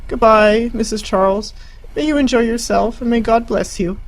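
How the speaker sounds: high, loud and quavering farewell; quivering voice. Acted. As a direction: call it out with a tremble. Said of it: "called out in a quivering voice".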